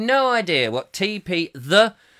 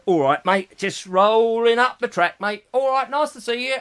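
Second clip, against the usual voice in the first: chirpy workman, lifting higher at the end; council worker; 4 semitones higher than usual. Acted. Council worker greeting people while rolling up track, chirpy and matey. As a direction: play a matey track labourer. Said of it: "this council worker".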